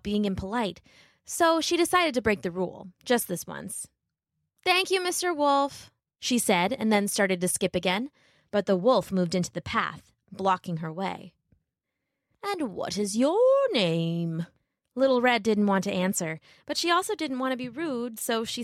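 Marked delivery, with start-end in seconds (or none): 4.6-5.9 put-on high, polite little girl voice; a small girl minding her manners. Little Red thanking the wolf, high and polite. 12.4-14.5 slow, sly wolf voice. Acted. Wolf coaxing Little Red on the path, slow and sly.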